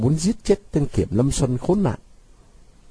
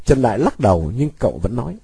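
plain reading voice

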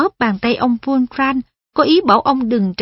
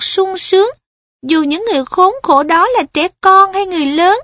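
put-on lightly higher young boy; a boy talking eagerly; earnest boy voice; 7 semitones higher, 3 dB louder than usual. Perrin speaks, voice raised.

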